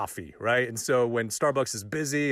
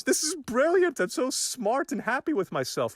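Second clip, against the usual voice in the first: smug high voice; 12 semitones higher than usual. Retold or imitated. Smug thought in hindsight.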